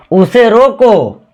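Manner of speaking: slow, stretched "उसे रोको" halting on रोको; stop after रोको. Shown as a demonstration. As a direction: draw out रोको, then halt. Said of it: "तो यहाँ कुछ तो रुकना होगा".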